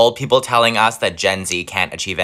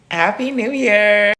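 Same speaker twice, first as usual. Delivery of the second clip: cheery toast; 10 semitones higher, slower than usual. Pretend. Toast with pie.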